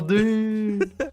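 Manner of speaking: high, loud, drawn-out mock battle cry; hero yelling his name. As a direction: belt the name out like a cartoon hero. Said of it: "yells it out".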